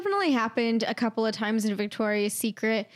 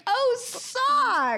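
high, exaggerated "oh" of recognition; mock sudden realization; 12 semitones higher than usual. Clerk finally understanding the request, mock sudden realization. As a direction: act out the penny finally dropping.

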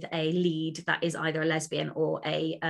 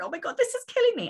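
much higher, swooning over a book; gushing excitement; faster than usual. Retold or imitated. Author recalling reading a love story, high gushing excitement. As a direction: gush like a smitten fan.

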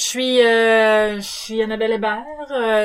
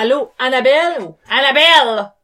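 louder, shifting into her Peninsula accent; loud Acadian accent; 3 semitones higher, 6 dB louder than usual. Shown as a demonstration. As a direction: say hi loudly so the accent slips. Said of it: "quand elle parle plus fort, elle change d'accent".

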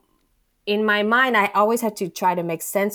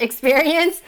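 laughing; 9 semitones higher, 7 dB louder than usual. Real, not acted. Laughing through the word.

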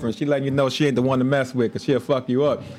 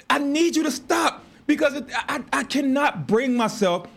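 pleading; 8 semitones higher, slower than usual. Retold or imitated. Retold plea to wife.

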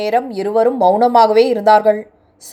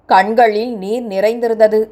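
ordinary delivery

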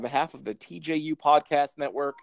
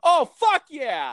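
drawn-out high thrilled yell; excited shout; 6 dB louder, much slower than usual. Pretend. Fan answering a call from David Lynch, slow thrilled shout. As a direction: yell it like your hero just called. Imagining Lynch calling him.

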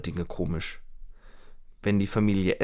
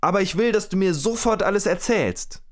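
higher, louder acted demand; demanding shout; 9 semitones higher, 3 dB louder than usual. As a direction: insist loudly, retold. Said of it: "schreit Rita".

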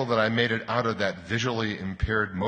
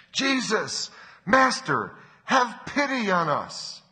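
high, raised plea, drawn out at the end; pleading shout, pitched way up; loud calling out; 13 semitones higher, 4 dB louder, slower than usual. A narrator's reading. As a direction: call from a distance for mercy. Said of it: "called out in a loud, loud voice".

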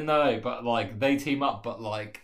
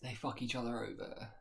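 very soft, secretive reveal; conspiratorial hush; 14 dB quieter than usual. Pretend. Revealing the plot twist to co-host, very hushed. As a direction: drop the twist under your breath.

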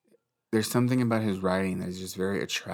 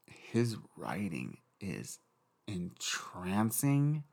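dreamy; 4 dB quieter, much slower than usual. Real, not acted. Dreamy praise of writing.

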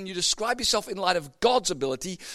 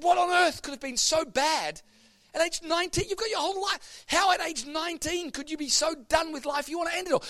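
pleading; 14 semitones higher than usual. Retold or imitated. Motorist begging nineteen-year-old woman, high and pleading. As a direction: plead with her, pitched up.

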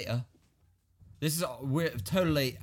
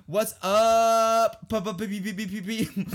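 loud held greeting into stuttered P's; mocking typed greeting; 6 semitones higher, 6 dB louder, slower than usual. Mocking her drawn-out text reply, loudly. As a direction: belt it, then sputter the letters. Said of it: "And then she responds with".

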